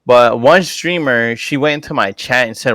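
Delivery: normal talking voice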